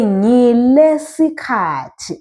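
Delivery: "Ngile sikhathi" very slow and drawn out; slow teacher's demo of "Ngile sikhathi"; model pronunciation. Shown as a demonstration. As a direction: stretch each vowel of "Ngile sikhathi" for learners. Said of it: "you say".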